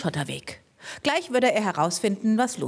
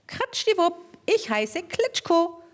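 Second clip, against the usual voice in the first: mole voice; 9 semitones higher than usual. Acted. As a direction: introduce yourself as a tiny creature. Mole introduces itself.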